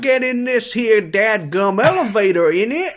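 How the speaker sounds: put-on high, loud botched Southern accent; British-Southern accent. Host showing a British person's try at Southern, hammed-up mixed accent. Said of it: "British person doing a Southern accent".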